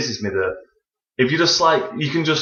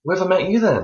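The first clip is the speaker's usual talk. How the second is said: put-on quoted girl; 8 semitones higher than usual. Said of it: "straight away she was like".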